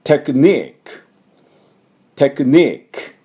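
テクニック as English "technique", slow, high, long "NEEK"; English-style テクニック, strong second syllable; stress on NI. Shown as a demonstration. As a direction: stretch and lift the ニ of テクニック, crisp final k. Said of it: "にのところを強く言えば".

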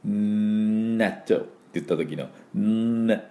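納豆 with a long sticky held N, "nnnattō"; 粘るN. Shown as a demonstration. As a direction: hold the N of 納豆 and ね, slow, like sticky natto. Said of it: "粘る納豆をイメージして、". (納豆 の頭を「ンーナットウ」と粘らせて)

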